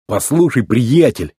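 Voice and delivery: plain reading voice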